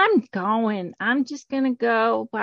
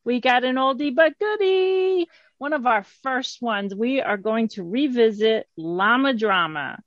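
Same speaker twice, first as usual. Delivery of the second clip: usual speaking voice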